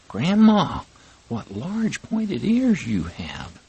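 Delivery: put-on high little girl's voice, puzzled; a child asking grandma, concerned; little girl worried.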